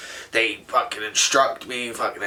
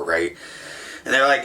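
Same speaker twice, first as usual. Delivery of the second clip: ordinary talk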